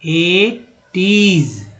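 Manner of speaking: एटीज stretched out very slowly, each sound clear; teacher drawing out एटीज; very slow demo. Shown as a demonstration. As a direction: drag out एटीज for the class. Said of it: "देखा मैंने यहाँ तोड़ के".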